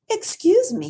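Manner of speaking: high, loud hippo voice; young hippo. Acted. Little hippo Portly greeting the bat, high voiced. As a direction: chirp it up eagerly as Portly.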